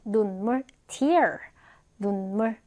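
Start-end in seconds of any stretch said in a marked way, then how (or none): 0.9-1.4 "tear" said like "tier", with an ee sound; noun pronunciation. Shown as a demonstration. Teacher shows students the noun, "tear" pronounced like "tier". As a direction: say "tear" to rhyme with "ear". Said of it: "tear 명사로 눈물이란 뜻이요".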